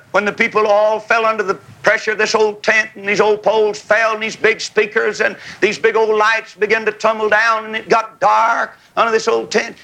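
normal talking voice

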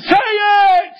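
very high, drawn-out holler; commanding shout. Retold or imitated. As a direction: explode into a preacher's yell. Re-enacts ordering the reluctant boy to speak.

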